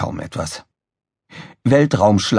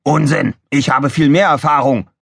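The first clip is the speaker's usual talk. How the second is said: insistent, higher and louder boast; Krawall, assertive; 6 semitones higher, 4 dB louder than usual. A narrator's reading. Officer contests engineer's command, assertive. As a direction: assert it firmly, pitched up. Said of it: "behauptete Krawall".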